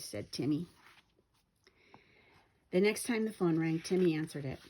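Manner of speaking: plain reading voice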